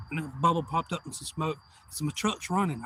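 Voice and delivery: usual speaking voice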